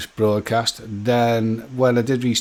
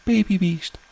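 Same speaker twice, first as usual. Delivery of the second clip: put-on "Baby Beast" lifted higher, cooing; cutesy Baby Beast; 7 semitones higher than usual. Shown as a demonstration. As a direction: coo the name like a pet. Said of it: "you have to say it like this".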